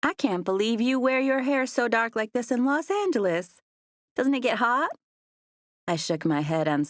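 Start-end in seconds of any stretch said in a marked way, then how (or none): 0.0-4.9 a hint of a drawling rich girl; London, Southern debutante. A narrator's reading. London voiced by narrator.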